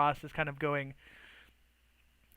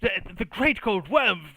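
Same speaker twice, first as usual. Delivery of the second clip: flustered envoy, pitched up with emphasis; flustered mage; 4 semitones higher, 5 dB louder than usual. Acted. Mage protesting to Artorias, flustered.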